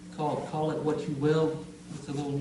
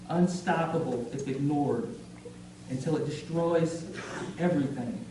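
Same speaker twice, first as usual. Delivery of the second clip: emphatic openings falling away at phrase ends; deliberate, punched poetry reading; spoken-word poem; 3 semitones higher than usual. Acted. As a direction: recite it gravely, with weighted pauses. Recites a poem on hate.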